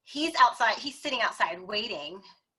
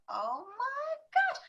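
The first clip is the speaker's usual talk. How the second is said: surprised; 4 dB quieter, slower than usual. Retold or imitated. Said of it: "And he was like".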